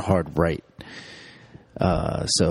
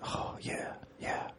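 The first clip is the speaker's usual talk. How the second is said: whisper in left ear; 18 dB quieter, much slower than usual. Pretend.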